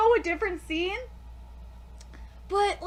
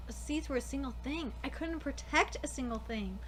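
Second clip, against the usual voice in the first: lower, softer, somber character reading; low solemn voice; 9 semitones lower, 12 dB quieter than usual. Acted. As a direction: read the sad adult's lines low and heavy. Reads manga characters in a low voice.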